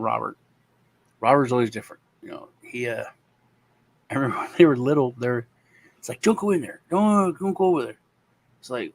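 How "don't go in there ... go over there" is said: lightly voiced dad, warning the kids high; a hint of a parent's don't-go-there. Dad to the little twins, mild warning.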